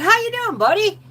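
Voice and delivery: high, bright buddy voice; quoting a man greeting a little boy; cheery greeting. Retold or imitated. Howie greets her child.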